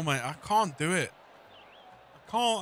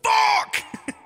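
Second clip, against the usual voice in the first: a loud, much higher curse at the miss; sharp high outburst; 6 dB louder than usual. Real, not acted. Gamer cursing a missed shot out loud. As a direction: blurt it out in frustration.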